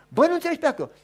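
loud, high, get lost; exasperated yell; fed-up neighbour. Acted. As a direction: shout him off, losing patience. Neighbour refuses again.

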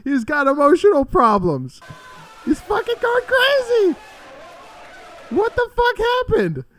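high, loud disbelieving yells; real laughing outrage at the screen; excited shouting. Real, not acted. Reacting to a fighter's meltdown.

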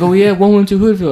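mocking the two guys trading addresses, high put-on voice; mock tough guy. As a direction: puff up and bark out the address like a street guy. Other guy's address, imitated.

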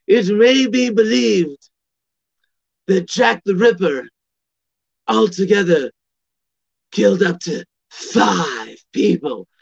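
put-on slow, ominous British accent, lightly done; British ghost-tour guide dragging out the dread; scary British tour guide. Comedian's tour guide spooking the group, slow British accent. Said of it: "using his scariest British voice".

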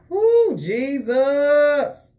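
high, drawn-out praise shout; exultant praise cry. Real, not acted. Preacher breaks into praise mid-sermon, exultant drawn-out cry. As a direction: cry out long and high in worship.

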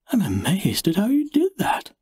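high, polite admiring woman. A narrator's reading. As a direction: sound impressed and sweet.

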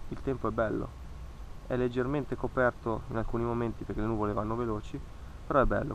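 ordinary talk

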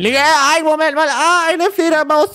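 hoch und aufgeschreckt; gespieltes Erschrecken. Acted. Erschrickt vor Fledermaus im Haus. (gespielt erschrocken)

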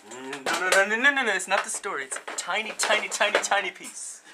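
loud, high, repeated denials; frantic protest. Real, not acted. Writer pressed about finishing the story, frantic protest.

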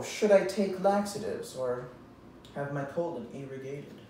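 ordinary delivery

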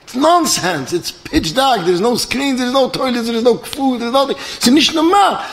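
high-pitched acted outrage, item after item; indignant rant. Passenger disputing the pilot's rescue claim, acted indignation. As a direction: protest like an aggrieved customer.

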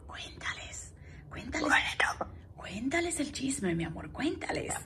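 whispered coaxing. Real, not acted. Owner whispering to the parrot to tell the gossip. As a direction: breathe it as a secret.

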